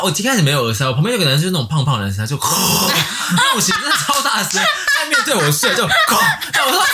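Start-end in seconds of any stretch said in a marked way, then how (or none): 2.4-3.3 burst of laughter. Real, not acted. Laughing at snorer memory. 5.9-6.5 very high squealing laugh; a high burst of giggles; shrieking laugh. Real, not acted. Laughing at snorer memory.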